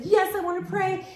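high, loud, eager answer; eager yes. Retold or imitated. The woman accepts prayer with a high, loud voice. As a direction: grab the offer with both hands. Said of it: "She said".

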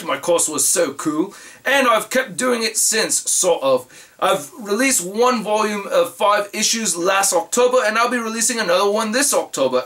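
put-on amateur British accent, reading aloud; imitation British letter-reader. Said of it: "do this in the British accent".

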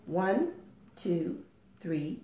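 usual speaking voice

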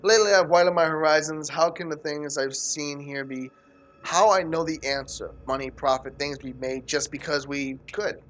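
low, measured, even narration; reading aloud. Acted. Player reading a found note to Lisa aloud, low narration. Reads game notes aloud.

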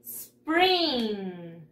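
SPRING drawn out, falling at the end; model pronunciation. Shown as a demonstration. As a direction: say "Spring" slowly for pupils to copy. (pronunciación modelo, alargada)

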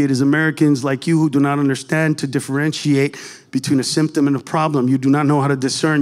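low-pitched rattled-off mock lecture; low, even, unbroken explanatory rant; on a flow. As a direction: deliver it as one rolling, learned argument. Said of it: "I got a flow going here".

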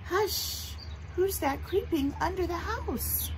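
high, slow lilting question; lullaby reading. Acted. Teacher reading lullaby verse to children, high and lilting.

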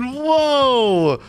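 high, drawn-out acted wonder; amazed kid. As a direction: say it like an awestruck child. Acting the child's amazed reply.

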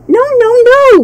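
high, loud terrified maid; frightened cry. A narrator's reading. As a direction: squeal it out in fear. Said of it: "cried the maid in reply".

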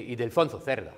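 put-on Spanish pronunciation; Spanish accent. Shown as a demonstration. Lecturer showing off the name to students, Spanish accent. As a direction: pronounce the name as if fluent in Spanish. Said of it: "je le fais bien, hein".

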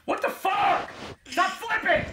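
very high-pitched real shout; frustrated yell. Real, not acted. Player raging at the game mid-play, frustrated high yell.

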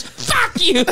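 high-pitched laughing fuck you; high mock curse. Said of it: "He goes".

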